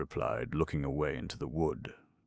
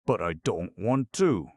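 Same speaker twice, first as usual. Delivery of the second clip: ordinary delivery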